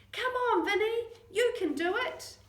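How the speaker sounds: high, loud cheering. Acted. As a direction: cheer him on. Cheering a runner on.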